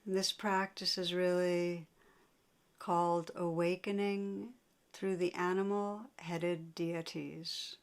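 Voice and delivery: unhurried meditation guidance with long pauses; guided meditation. Real, not acted. Teacher guiding listeners to sense painful emotions, guided meditation. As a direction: lead them inward, leaving long silences between phrases.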